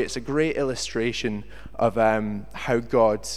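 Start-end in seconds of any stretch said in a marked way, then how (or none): none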